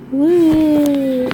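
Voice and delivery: high gleeful whoop; excited whoop. Real, not acted. Reader cheering the story couple, gleeful high whoop.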